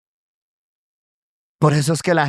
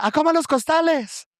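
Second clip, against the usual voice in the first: high-pitched schoolyard taunt; taunting tease; 10 semitones higher than usual. Retold or imitated. Schoolmates teasing a Pentecostal boy about sacks, high taunt. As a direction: pester with a high, teasing call. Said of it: "ya me daba rabia y me decía".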